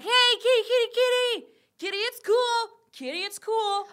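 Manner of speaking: very high, falsetto coaxing call; improv troupe coaxing a cat. Acted. Improv troupe trying to calm a giant cat, coaxing falsetto. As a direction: sing out to a cornered cat in falsetto. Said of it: "you see a hero team of four".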